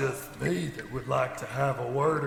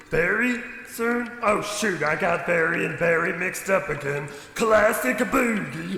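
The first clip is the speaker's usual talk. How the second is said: higher and louder silly slip-up; goofy cheerful blunder; 4 semitones higher, 4 dB louder than usual. Acted. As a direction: ham up the mix-up.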